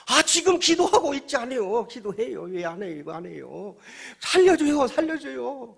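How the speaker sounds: high shouted pleading in bursts; desperate protest. Acted. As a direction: protest loudly, then scream for rescue. Man shouts pleas to God.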